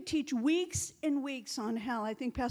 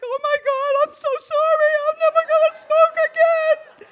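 much higher and louder, frantic pleading with a laugh breaking through; retold panicked crying; 18 dB louder than usual. Preacher reenacting her plea to God under the fireball, hammed-up panicked wail. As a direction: relive the terror as a half-laughing wail. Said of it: "I'm just crying".

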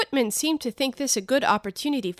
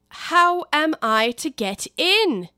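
louder; 6 semitones higher, 5 dB louder, much slower than usual. Acted. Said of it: "asked Alice again in a louder tone".

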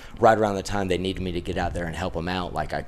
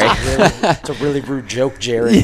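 laughing; 7 semitones higher, 6 dB louder than usual. Real, not acted. Laughing at a joke.

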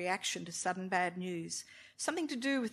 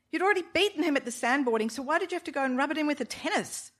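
higher, louder, fast rebuke; fast scolding wife; 7 semitones higher, 6 dB louder, faster than usual. A narrator's reading. Wife scolding husband about beating his boss, fast and high. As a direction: rattle off the scolding.

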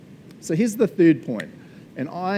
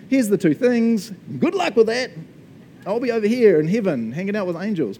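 higher-pitched flippant brush-off; mock breezy send-off; 6 semitones higher, 3 dB louder than usual. Preacher imagines God leaving people to it, mock flippant. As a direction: toss it off high, in pretend indifference.

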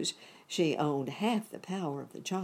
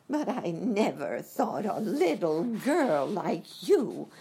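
melting witch; 8 semitones higher, 4 dB louder, slower than usual. A narrator's reading. Melting witch's last speech.

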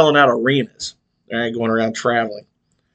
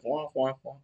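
muffled, much softer wah wah drone; Charlie Brown teacher voice; 13 dB quieter than usual. Retold or imitated. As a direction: imitate the muffled grown-up from the cartoon. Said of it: "Charlie Brown school teacher voice".

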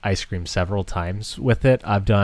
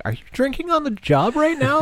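high, mock-scandalized question; accusing coworker. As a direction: gasp it out like a nosy coworker. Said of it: "everyone's like".

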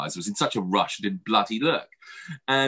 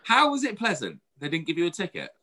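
much higher disbelief; incredulous; 7 semitones higher than usual. Real, not acted. Questioning the cohost's pleasant warden story. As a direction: ask it in disbelief.

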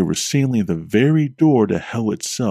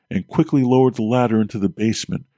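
ordinary delivery, nothing marked

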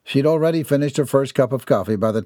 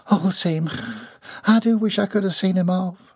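higher, wistful Southern lady; 9 semitones higher than usual. Acted. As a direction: sigh it out as a regretful mother figure. Voices lady in story.

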